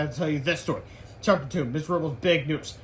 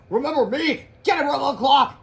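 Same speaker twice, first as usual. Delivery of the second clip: high, loud battle cry; hammed-up combat shout; confronting foe; 9 semitones higher, 7 dB louder than usual. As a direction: challenge the enemy like a cartoon fighter. Comic combatants confront each other.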